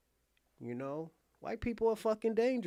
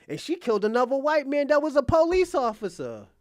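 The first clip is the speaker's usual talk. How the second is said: ordinary talk, nothing marked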